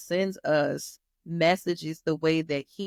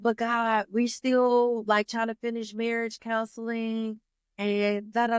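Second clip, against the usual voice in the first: higher pitched, whiny plea; wife pleading with God to keep the marriage going, higher and whiny; pleading bargain; 5 semitones higher than usual. Retold or imitated. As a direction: bargain with God like a kid making excuses.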